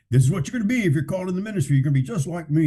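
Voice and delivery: put-on high, fast, preachy pastor lecturing young recruits; mock pastor persona. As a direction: rattle it off like a smug pastor setting himself up as the model. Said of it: "they put on that, that, that persona".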